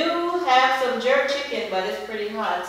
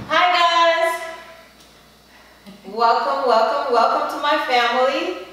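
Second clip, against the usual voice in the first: bright, louder hello to viewers; excited greeting; 4 semitones higher, 5 dB louder than usual. Real, not acted. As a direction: welcome everyone warmly and loudly.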